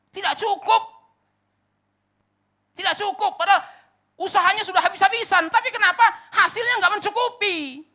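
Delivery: high, drawn-out, building to loud cries; impassioned preaching lament. Acted. Preacher voicing weary workers' frustration, building to loud outcry. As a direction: climb from slow complaint to full-force shout.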